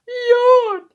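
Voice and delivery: very high loud yawn; big yawn. Acted. Animal yawns.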